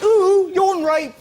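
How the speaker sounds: high, slow drawn-out ooh; mock swooning coo. Retelling a woman's reaction, high and drawn out.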